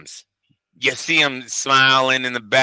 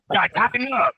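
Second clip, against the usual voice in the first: lightly put-on mocking voice, pitched up; mock tough-guy voice; 7 semitones higher than usual. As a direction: parrot the line back in a goofy voice. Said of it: "Miguel, you gotta tighten it".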